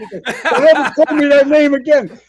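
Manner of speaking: half laugh, half roar; laughing yell. Retold or imitated. Chief laughing at the prank, loud and high.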